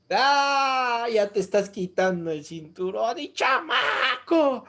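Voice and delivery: playful scold like a mother reaching for the belt; mother hammed-up scolding her lost kid; mock angry mother. Mother threatening found child.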